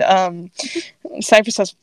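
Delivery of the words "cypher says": ordinary delivery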